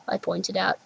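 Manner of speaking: ordinary delivery, nothing marked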